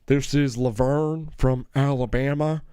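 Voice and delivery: slow, hammed-up Southern drawl; fake Southern accent. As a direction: imitate a kid badly faking a Southern accent. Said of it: "trying to do a fake Southern accent".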